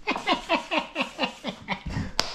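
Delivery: short breathless giggle; high airy giggle of delight at the tool. Real, not acted. Delighted with new sanding tool.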